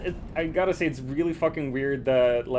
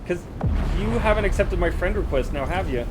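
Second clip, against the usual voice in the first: teasing; 5 semitones higher, 5 dB louder than usual. Acted. Teasing accusation to friend.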